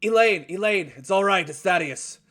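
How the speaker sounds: high, loud call up the stairs; dwarf calling up to his wife upstairs. Acted. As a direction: call out to the floor above. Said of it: "he calls upstairs".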